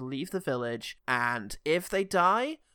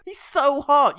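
higher, louder swoon; swooning gush; 9 semitones higher, 9 dB louder, slower than usual. Acted. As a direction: gush over the stranger. Swooning women.

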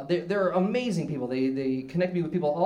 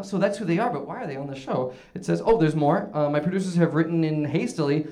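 ordinary talk, nothing marked

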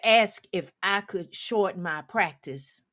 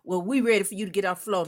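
rattled off fast, mocking; much faster than usual. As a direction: mimic them quickly, sarcastic. Mocking impatient players.

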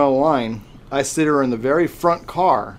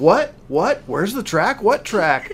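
high, startled questions; imitated panicked child; 7 semitones higher, slower than usual. Daughter on the lift hill answering dad, imitated panic. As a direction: mimic an alarmed little girl. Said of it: "she starts freaking out".

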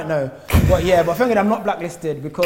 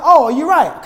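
breezy loud greeting; 10 semitones higher, 12 dB louder than usual. Retold or imitated. Casual reply to recognising girls.

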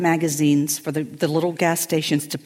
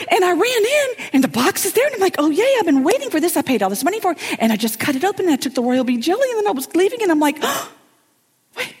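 much higher, louder excited retelling; higher breathless friend voice; high flustered friend; 10 semitones higher, 3 dB louder than usual. Retold or imitated. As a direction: bubble it out in her high voice. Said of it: "And she goes".

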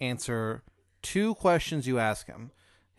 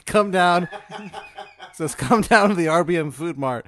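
loud, high, laughing call to come down; loud mock summons; 7 semitones higher, 11 dB louder than usual. Game master reading out the mysterious note, loud and laughing. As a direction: bellow it through a laugh.

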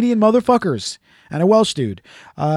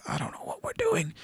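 whispered panic; breathless whispered confusion; 14 dB quieter than usual. Retold or imitated. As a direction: hiss it in a whisper. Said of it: "and I'm like".

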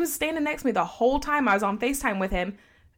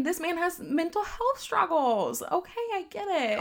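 mock sympathetic; 8 semitones higher, 3 dB quieter than usual. Mock understanding of boyfriend.

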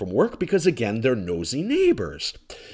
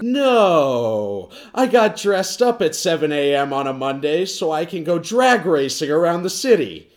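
dry, mocking comeback; sarcastic reply; 6 semitones higher, 4 dB louder than usual. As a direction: answer with heavy sarcasm. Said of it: "reply to neighbor".

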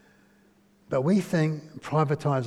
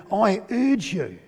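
higher, slow and stretched plea; emotional urging; 4 semitones higher, slower than usual. Acted. Paul pleading with the church he fathered, emotional urging. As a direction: lean long into "urge". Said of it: "there's a lot of emotion in Scripture".

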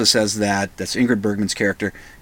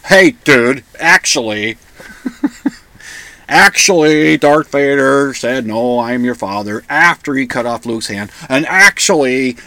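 mock pedant; 3 dB louder than usual. Pedants emailing the podcaster corrections, mock know-it-all. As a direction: correct them like a pedant, stretching the key words. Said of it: "start getting emails saying".

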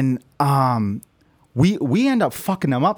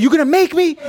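high, loud laughing dare; mock bouncer taunt; challenging; 12 semitones higher, 6 dB louder than usual. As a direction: throw the challenge back.

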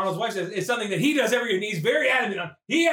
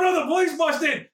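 normal talking voice

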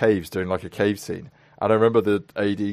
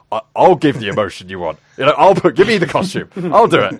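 eager outburst; 5 semitones higher, 7 dB louder than usual. Retold or imitated. Desperate to finish before tide.

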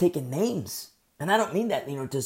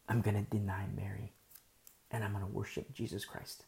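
softer, lower, tender and hushed; hushed devotion; 5 semitones lower, 9 dB quieter than usual. Acted. Teacher voicing a convert's devotion to Jesus, hushed and tender. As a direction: let it fall to a reverent hush.

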